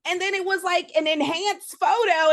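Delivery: normal talking voice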